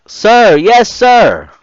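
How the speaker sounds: loud, high group shout; recruits yelling back. Retold or imitated. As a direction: shout the reply in unison. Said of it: "hesitantly yelled back".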